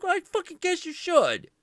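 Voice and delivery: very high, loud biting sarcasm; angry wife mocking Shiva's apology; shrill sarcastic fury. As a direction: spit it back at him.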